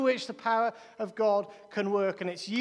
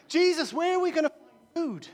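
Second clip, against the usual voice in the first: lightly acted complaint, raised pitch; mildly hammed-up hungry disciples pleading; 4 semitones higher, 3 dB louder than usual. Disciples asking Jesus where to find food, lightly acted complaint. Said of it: "the disciples go, they're hungry".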